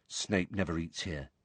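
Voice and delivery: low, soft confiding voice; quiet. A narrator's reading. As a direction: tell a friend on the quiet. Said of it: "Ron told Harry quietly".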